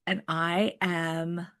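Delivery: ordinary talk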